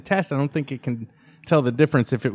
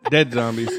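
after laughing hard; 4 semitones lower than usual. Real, not acted. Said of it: "my cheeks hurt".

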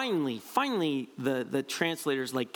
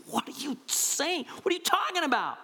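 indignant Peter; 11 semitones higher than usual. Retold or imitated. Peter pulls Jesus aside, shocked: sharp, high rebuke. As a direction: get up in his face, baffled and upset. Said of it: "He's like".

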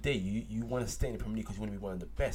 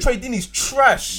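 higher, much louder, slow; loud scornful verdict; loud dismissal; 11 semitones higher, 14 dB louder, much slower than usual. Real, not acted. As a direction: shout it out. Heated football argument.

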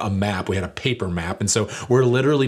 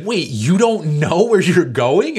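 much higher, louder, slow incredulous question; disbelief; 9 semitones higher, 7 dB louder, slower than usual. Retold or imitated. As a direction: everyone asks in shock. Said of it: "everyone's like".

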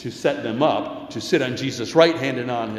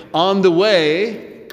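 "On the way" slow, higher and louder; stressed phrase; 6 semitones higher, 6 dB louder, much slower than usual. Real, not acted. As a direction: lean into "On the way," drawn out. Sermon emphasis on the way.

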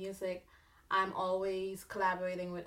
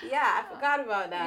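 laughing; 3 semitones higher, 7 dB louder than usual. Real, not acted. Laughing at own story.

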